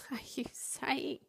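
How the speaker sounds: whispered through giggles; half-laughing disbelieving whisper; giggling whisper. Retold or imitated. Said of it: "I kind of got the giggles".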